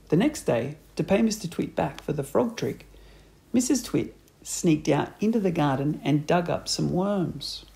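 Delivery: ordinary delivery, nothing marked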